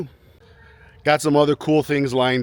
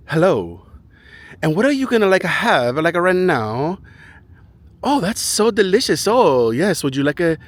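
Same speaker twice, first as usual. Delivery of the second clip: mock Latin American Spanish-accented barista, pitched up; South American Spanish accent; 6 semitones higher, 5 dB louder than usual. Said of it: "South American Spanish English accent".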